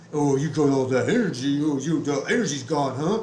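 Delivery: hammed-up impression of a jeering stranger; mocking taunt. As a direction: throw it back like a smug heckler. Quoting the guy's taunt.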